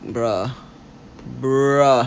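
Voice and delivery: low, drained "bruh"; worn-out groan. Acted. Host acting out coming home from work, worn out. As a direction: sigh it out, too tired to care. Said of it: "After I get off work, I'll just be like".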